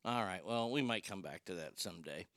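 ordinary talk

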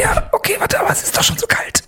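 high, breathy shivering protest; in ice cold water. Acted. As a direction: complain through the freezing cold. Cold opener, freezing in cold.

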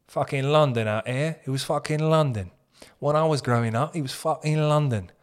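put-on London accent. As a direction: talk like a bloke from London. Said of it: "that guy does sound like he's from".

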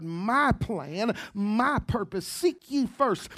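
loud, rising preacher's cadence building to a roar; full-force preaching. Acted. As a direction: preach it out to the congregation, building with every phrase.

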